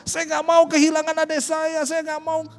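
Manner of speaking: retold plea to God, pitched high and strained; high, tearful retelling of a plea not to lose her sister; desperate plea. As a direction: beg God high and near tears, as she once did.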